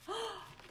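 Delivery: sharp high gasp; frightened intake of breath; startled gasp. Acted. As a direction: gasp in shock. Gasp at giant.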